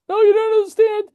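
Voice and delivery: high, loud, overwhelmed mother voice; mother explaining her baby never cried before; frantic crying mother. Retold or imitated. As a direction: squeal it out like a mother in tears of relief. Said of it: "And she goes".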